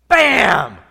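sudden loud bam sound effect. Retold or imitated. A preacher mimicking the ship's gun firing, loud bam. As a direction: blurt out the gun blast.

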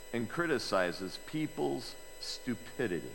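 ordinary delivery

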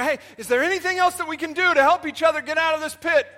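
high, loud and fast, eager; urgent frog voice. Acted. Frogs in the pit urgently asking each other, high and fast. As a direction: rush out the question.

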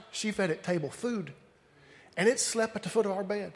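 soft, understated grandfather retelling; hushed storytelling. Retold or imitated. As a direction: tell it quietly, as grandpa did. Imitating grandfather's quiet storytelling.

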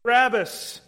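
high, loud crowd demand for Barabbas; crowd shouting. Acted. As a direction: call out like a demanding mob. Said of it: "the crowd ... says".

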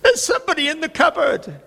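put-on high, frightened child's voice; little girl, scared, high-pitched. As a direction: play the small daughter insisting in fear. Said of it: "And she said to me".

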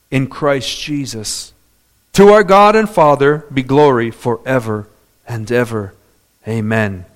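scripture reading. Acted. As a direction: read the verse out deliberately, with weighty pauses. Reads scripture aloud to congregation.

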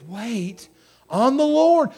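"Wait on the Lord" drawn out slowly; emphatic exhortation. Real, not acted. Preacher urging the church, "Wait on the Lord" slow and emphatic. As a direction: stretch each word of "Wait on the Lord". Preacher drawing out key command.

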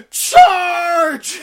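loud rallying yell of charge. Acted. Pastor rallying the congregation, loud yell. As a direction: shout it like a battle cry. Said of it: "I'm yelling as your pastor".

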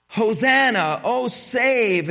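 loud, lifted Hosanna; children crying out. Retold or imitated. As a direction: call it like excited children. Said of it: "children are crying out".

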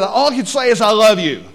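loud, high blurted vow; nervous blurt. Retold or imitated. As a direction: blurt it out like a panicked groom. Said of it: "he just blurted out".

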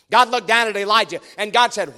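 ordinary talk, nothing marked

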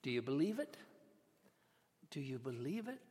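low, quiet, direct question rising at the end; softly pointed challenge; point-blank question. Acted. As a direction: ask it squarely, hushed on the repeat. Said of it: "point blank".

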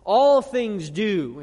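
"All" hit hard and loud, slow; stress on all. Shown as a demonstration. The pastor showing the church the Greek word order, "All" stressed loud and slow. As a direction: lean on "All" to show it comes first in the Greek. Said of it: "it emphasizes the all things aspect".

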